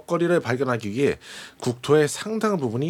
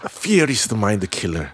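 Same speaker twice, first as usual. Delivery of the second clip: low, very slow, weighty English delivery; famous movie line; 6 semitones lower, much slower than usual. Acted. Host cues the famous Dune line with music, low solemn recital. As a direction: intone it low and drawn out, like a solemn credo. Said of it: "듄의 명대사, 지금 빅인 삼 번 가줘요".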